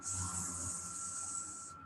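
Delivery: unvoiced hissing s; hissed s sound. Shown as a demonstration. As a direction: hiss the letter s. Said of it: "Can you say it again?".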